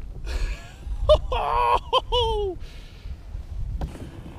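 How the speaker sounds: breathless high-pitched giggle; giddy high laugh. Real, not acted. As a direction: laugh out in pure joy. Thrilled by the catch.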